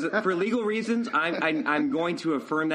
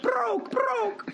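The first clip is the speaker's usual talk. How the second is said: mock broke. Mocking broke Cash Money crew.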